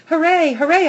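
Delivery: high, loud happy cheer; joyful barking. A narrator's reading. Dog greeting Hattie at the gate, high excited cheer. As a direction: burst out like a thrilled puppy. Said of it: "I bark".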